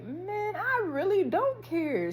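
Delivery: high, slow, drawn-out shrug-off; dismissive self-talk. Retold or imitated. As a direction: stretch it out high, acting unbothered. Acted reply to himself.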